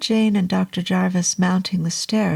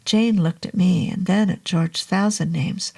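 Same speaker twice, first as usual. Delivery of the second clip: usual speaking voice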